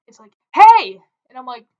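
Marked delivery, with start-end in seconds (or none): 0.5-1.0 loud, high holler to get attention; imitated shout. Homeless man hollering at a passerby, imitated shout. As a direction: call out sharply across a station. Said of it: "I hear this shouting".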